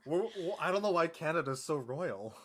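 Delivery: ordinary delivery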